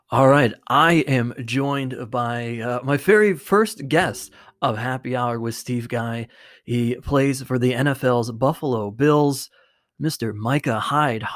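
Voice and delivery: announcer-style welcome of the first guest; guest introduction. Acted. Host introducing his first guest, announcer style. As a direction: present the guest like a show host.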